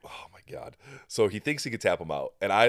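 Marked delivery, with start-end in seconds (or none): 0.0-0.8 breathy whispered aside; whispered oh my God. Real, not acted. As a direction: drop to a whisper in disbelief. Reacting to the Conor McGregor claim.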